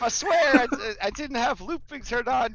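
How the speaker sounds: very high, loud apology to the table; real flustered backpedalling; flustered reassurance. Real, not acted. Game master reassures players after mistake.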